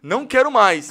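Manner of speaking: loud, high exasperated refusal; fed-up protest. Retold or imitated. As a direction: refuse it like you've had enough. Retold refusal of yet another course.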